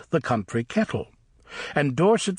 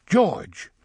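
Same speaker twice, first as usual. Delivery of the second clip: forceful interruption; 7 semitones higher than usual. A narrator's reading. Father James cutting George off, forceful. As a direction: stop George mid-sentence with his name. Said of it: "Father James interrupted forcefully".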